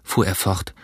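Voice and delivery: usual speaking voice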